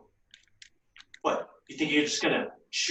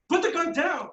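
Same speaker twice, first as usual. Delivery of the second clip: shouting; 12 semitones higher than usual. Acted. Pleading with gunman.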